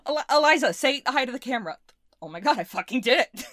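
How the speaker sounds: breathless laughing victory; giddy triumph. Acted. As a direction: celebrate like a mad scientist who won.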